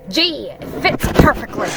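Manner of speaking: pleased, emphatic character exclamation; exclaiming. A narrator's reading. Tigger trying on the coat, exclaiming. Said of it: "exclaimed Tigger".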